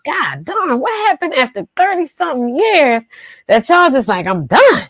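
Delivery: high, stunned disbelief; baffled disbelief. Retold or imitated. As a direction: ask it like you can't believe it. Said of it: "and you're like".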